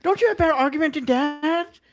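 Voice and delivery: high, loud, exasperated scolding of China; mock exasperation. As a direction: throw up your hands and scold a whole country.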